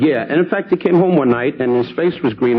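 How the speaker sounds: normal talking voice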